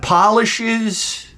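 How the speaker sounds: ordinary talk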